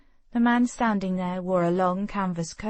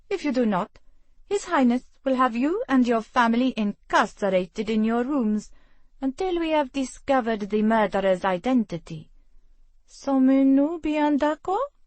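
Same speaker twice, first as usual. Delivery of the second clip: a French official, louder and higher, firmly warning; French accent; 5 semitones higher, 5 dB louder than usual. Acted. French mayor warns the guests, commanding in French accent. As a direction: lean into the French accent with authority.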